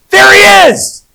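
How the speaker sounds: sudden high excited cry; excited shout. Retold or imitated. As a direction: call it out thrilled, pitch jumping up. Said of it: "my wife says".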